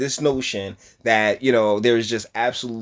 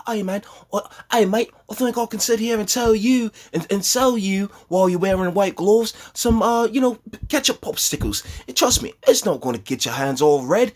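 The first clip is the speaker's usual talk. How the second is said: a pitched-up, hammed-up British accent; mock British accent; 8 semitones higher than usual. Mocking the guest's British accent to his viewers, a pitched-up hammy imitation. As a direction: parody a smooth British salesman accent. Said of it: "you hear the accent, right?".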